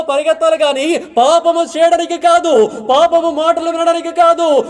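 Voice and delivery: rising pulpit fervour, pressing on every line; fiery preaching. Acted. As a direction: preach it hard to the young people. Preaching rises to full force.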